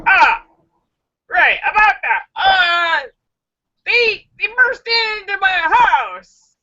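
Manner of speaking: high, hammed-up stammering excuse; high, flustered phony victim; caught-out liar. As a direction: scramble for an alibi in mock panic. Acts man improvising alibi to cops.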